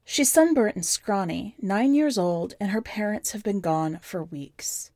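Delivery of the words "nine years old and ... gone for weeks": ordinary delivery, nothing marked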